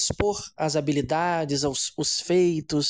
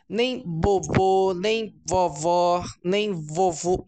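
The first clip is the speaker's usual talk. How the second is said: put-on slow, drawn out baby words; much slower than usual. Shown as a demonstration. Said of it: "Quando eu digo não falar nada".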